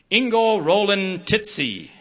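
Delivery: high, loud, slow, hammed-up announcing; mocking name reading. Dean mispronouncing the graduate's name, high, loud and slow. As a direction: draw the name out to get a laugh. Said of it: "seized his opportunity to be funny".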